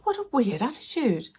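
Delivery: high, disbelieving inner voice; incredulous. Retold or imitated. Thinking about the rude shop man, high and incredulous. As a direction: pitch it up in baffled disbelief.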